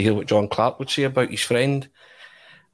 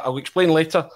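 higher, grand dismissive star; 7 semitones higher than usual. Retold or imitated. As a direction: wave it off like a host.

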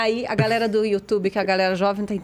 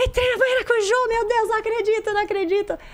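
much higher, squealing in disbelief; excited squeal; 11 semitones higher than usual. Retold or imitated. In the dressing room after the show, an excited squeal. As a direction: squeal like a thrilled fan.

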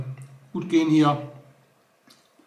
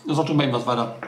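talking while chewing; mouth full; much faster than usual. Real, not acted. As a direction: speak through a mouthful. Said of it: "Mit vollem Mund spricht man nicht".

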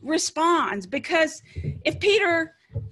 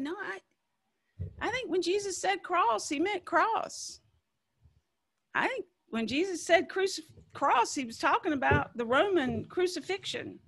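soft, higher, dawning voice; quiet realization; 4 semitones higher, 5 dB quieter than usual. Retold or imitated. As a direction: quietly work it out. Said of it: "somebody said".

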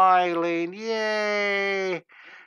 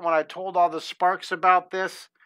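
ordinary talk

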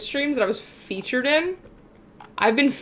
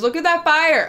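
excited boast; 7 semitones higher, 7 dB louder than usual. Real, not acted. Showing off the fire stat.

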